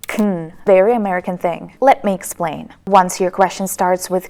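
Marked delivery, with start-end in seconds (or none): 0.1-0.5 "kn" with no vowel, k straight into n; reduced "can". Shown as a demonstration. Teacher names a very American tip, reduced "kn". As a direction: swallow the vowel in "kn". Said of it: "is called "kn".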